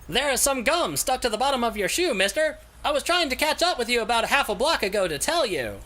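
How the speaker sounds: high, put-on voice of a panting stranger; out-of-breath passerby voice; stranger's voice.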